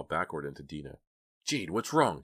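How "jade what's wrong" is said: startled cry. A narrator's reading. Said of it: "Dina cried, startled".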